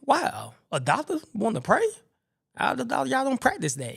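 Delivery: high, amazed questioning; retold astonishment with pitch leaping up; shocked patient. Patient stunned that a doctor wants to pray, high and incredulous.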